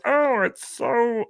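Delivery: high, slow, nasal-free cartoon Martian whine; Marvin-like Wiz. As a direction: stretch it out slow like a little cartoon alien. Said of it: "he's a ripoff of the guy that goes".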